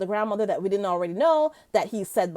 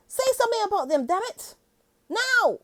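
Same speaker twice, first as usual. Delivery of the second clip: higher, hammed-up demanding voice; mock demand; 9 semitones higher than usual. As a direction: play the pushy TV host, mockingly. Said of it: "he's saying".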